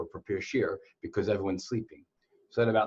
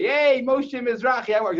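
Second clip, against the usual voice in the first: mock cheery greeting; 12 semitones higher, 5 dB louder than usual. Imagined wrong-name greeting.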